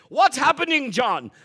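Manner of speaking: put-on loud, high faith-healer call with his accent; a mock Benny Hinn accent calling to a helper. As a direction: boom it out like a healer on stage. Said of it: "He's got the accent".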